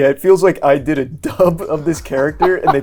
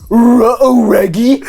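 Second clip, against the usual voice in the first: loud, raised cartoon dog voice; Scooby-Doo; 7 semitones higher, 6 dB louder, slower than usual. Showing friends the only voice they can do, cartoon dog impression. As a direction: belt out a goofy talking-dog catchphrase. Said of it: "I can only do Scooby-Doo saying one line".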